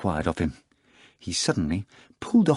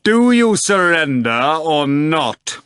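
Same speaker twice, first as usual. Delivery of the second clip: loud, slow, menacing demand; a louder, slowed threatening master's shout; fearsome shout; 7 semitones higher, 7 dB louder, slower than usual. A narrator's reading. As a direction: bellow it to cow the cat. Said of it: "shouted in a fearsome voice".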